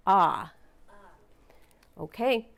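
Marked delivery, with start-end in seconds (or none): none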